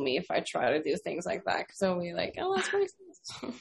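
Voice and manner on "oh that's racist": high, soft, slow mocking voice; mock accuser. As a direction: imitate a scandalized critic in a small high voice. Mimics imagined critics objecting.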